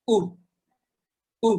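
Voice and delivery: each "উ" cut very short; quick "উ" for the class to repeat. Shown as a demonstration. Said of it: "আমরা যখন short উ".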